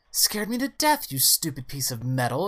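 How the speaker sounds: high-pitched startled scolding; indignant exclamation. Acted. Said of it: "exclaims Mother".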